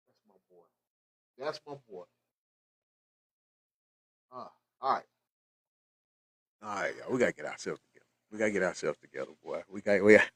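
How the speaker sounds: tearful, hushed and broken; overcome, trying to pull himself together; real tears, voice nearly gone; choked up. Real, not acted. Father overcome reading son's news.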